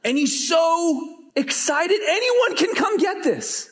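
high, slow, stretched-out elation; giddy imitation of excitement; mock amazed excitement. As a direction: marvel at it in a high, elated voice. Preacher mirrors the excited man.

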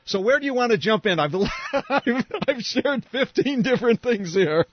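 fast, high, laughing rush; amused, giggly overflow of words. Real, not acted. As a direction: laugh through the question at yourself. Laughing at her own long list.

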